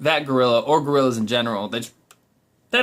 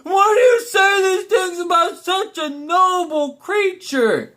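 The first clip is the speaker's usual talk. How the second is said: high, slow, hammed-up indignant shout; mock horrified cry of a scene-maker; melodramatic outrage; 3 dB louder, slower than usual. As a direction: play the wounded martyr at the dinner party. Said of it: "that person's like".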